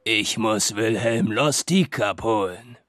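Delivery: slow, hollow voice from the grave; distant sepulchral voice. Acted. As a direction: speak like death itself, slowly. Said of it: "mit einer fernen Grabesstimme".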